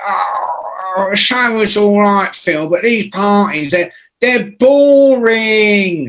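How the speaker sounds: high, drawn-out bored sneer. Retold or imitated. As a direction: stretch out the boredom with a snotty put-on voice. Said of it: "And, and [name] goes".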